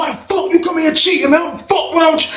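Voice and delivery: high furious yell; angry shout. Retold or imitated. Angry player yells at the cheater during the beating, high. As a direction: scream at the cheat.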